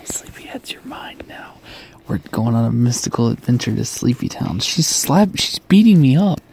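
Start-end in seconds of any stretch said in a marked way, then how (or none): 0.1-1.5 hushed creepy whisper. Acted. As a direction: whisper it like a secret takeover. Taking over podcast, whispering.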